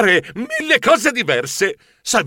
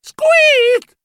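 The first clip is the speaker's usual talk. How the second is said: very high, drawn-out squeak; startled mouse squeal; 5 dB louder than usual. A narrator's reading. Narrator voicing a mouse hero's surprise, shrill squeal.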